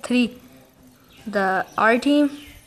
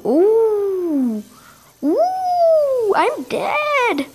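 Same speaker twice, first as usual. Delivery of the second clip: high-pitched wailing "ooh"; shrill-rising alarm, drawn out; panicked exclamation; 10 semitones higher, much slower than usual. Real, not acted. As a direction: squeal as the game turns on you. Panics as game death nears.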